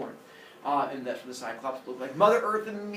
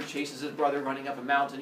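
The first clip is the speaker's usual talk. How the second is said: ordinary delivery, nothing marked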